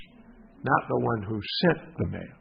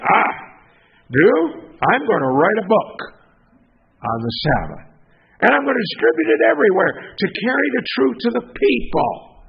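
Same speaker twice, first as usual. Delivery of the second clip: louder, rising, resolute vow; proud declaration; 7 semitones higher, 5 dB louder than usual. Acted. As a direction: stand tall and proclaim the plan. Husband proudly announces his plan.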